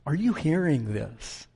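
ordinary talk

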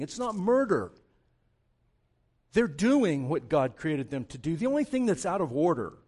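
loud, fervent preaching. Real, not acted. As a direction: preach it hard to the congregation. Preaches at full force.

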